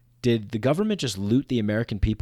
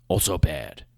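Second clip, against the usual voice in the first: mock dumb voice; 8 semitones lower than usual.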